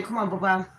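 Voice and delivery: ordinary talk, nothing marked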